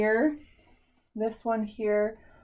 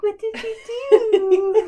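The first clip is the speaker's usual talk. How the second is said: high, laughing cry of shock; half laugh, half wail at the new hair; high laughing disbelief, growing louder; shocked laughing mom; 13 semitones higher, 8 dB louder, slower than usual. Retold or imitated. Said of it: "And I'm like".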